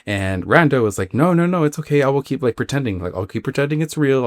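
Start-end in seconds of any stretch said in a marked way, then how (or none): 1.1-4.2 higher-pitched, placating protest; hasty reassurance. Retold or imitated. Dreamer calming her panicking friend, mock-anxious reassurance. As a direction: talk someone down, pitched up.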